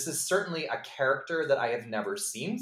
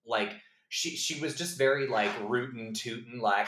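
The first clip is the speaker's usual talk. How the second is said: ordinary talk, nothing marked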